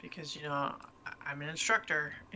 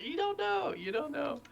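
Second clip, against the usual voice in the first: very high, playful voice; squeaky-high joking repeat; playful high refrain; 10 semitones higher than usual. Pretend. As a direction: jump way up in pitch, joking. Joking refrain after laughing.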